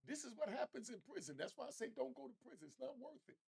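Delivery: ordinary talk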